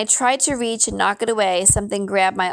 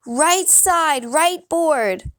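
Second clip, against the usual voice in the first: high, urgent call; witch's warning shout; 8 semitones higher, much slower than usual. A narrator's reading. Witch shouting from her shack to the running boy. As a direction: pitch it up like shouting across a distance. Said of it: "The witch shouted at me".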